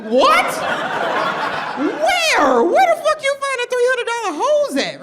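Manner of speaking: high, loud, incredulous squeal; shocked disbelief. Retold or imitated. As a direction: go way up in outraged disbelief. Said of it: "I was like".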